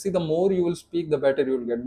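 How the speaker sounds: ordinary talk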